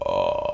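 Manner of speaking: low creaky fry, each vibration crackling; vocal fry demo. Shown as a demonstration. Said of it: "and it goes like this".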